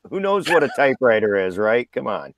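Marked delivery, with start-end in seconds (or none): none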